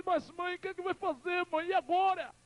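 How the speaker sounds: acted despairing plea. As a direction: cry out helplessly to a mother. Son despairs before dying mother.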